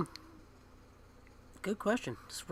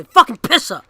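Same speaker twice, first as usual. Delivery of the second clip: screamed curse, much louder and higher; showing how he sounds when really mad, full yell; angry scream; 7 semitones higher, 13 dB louder than usual. Retold or imitated. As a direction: yell it straight into the mic. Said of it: "And he just screamed into the microphone".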